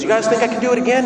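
playful circus performer working the crowd; tightrope walker. Acted. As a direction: call out quickly to the crowd as the performer. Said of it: "And he goes".

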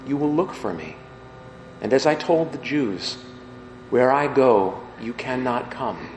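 measured, solemn reading of scripture; gospel reading. Acted. Priest reading the gospel aloud at Mass, solemn and measured.

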